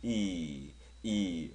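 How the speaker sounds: 이 held long with the tongue set and pulled back; long drawn ee. Shown as a demonstration. Teacher demonstrating a long ee to students, drawn out. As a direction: stretch the 이 out slowly. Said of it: "길게 뒤로 끌고 가면서 발음하면".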